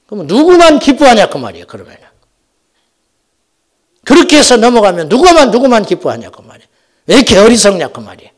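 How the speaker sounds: loud, exasperated exhortation; forceful preaching. Acted. Pastor rebuking a church that fights over nothing, exasperated preaching. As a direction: rebuke the congregation from the pulpit.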